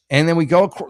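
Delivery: usual speaking voice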